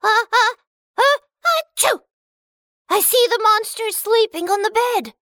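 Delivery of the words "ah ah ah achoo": high wind-up ending in a sneeze; about to sneeze. Acted. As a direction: hold the ahs, then sneeze. Sneezing dwarf.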